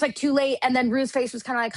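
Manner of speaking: usual speaking voice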